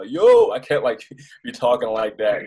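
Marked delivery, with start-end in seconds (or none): 0.1-0.5 a loud, high Baltimore street "Yo"; Baltimore accent. Shown as a demonstration. Showing why Baltimore talk won't work on air, Baltimore accent. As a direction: throw out "Yo" the way Baltimore says it. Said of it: "talking like I'm from Baltimore".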